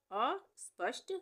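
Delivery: अस्पष्ट slow, high अ, pause, स्पष्ट; broken-up dictation. Shown as a demonstration. Teacher repeating for spelling, अस्पष्ट split after a high अ. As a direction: lift अ, then add स्पष्ट separately.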